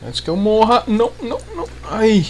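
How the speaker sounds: rushed panic about dying; panicking gamer. Real, not acted. Racing to loot before dying.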